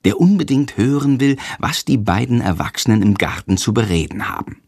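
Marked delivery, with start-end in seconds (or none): none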